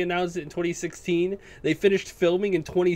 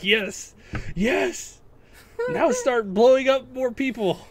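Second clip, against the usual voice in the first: rising giddy yes, yes, higher each time; gleeful excitement; 7 semitones higher, much slower than usual. Retold or imitated. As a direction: egg the movie on like a kid watching explosions. Gleeful retold movie reaction.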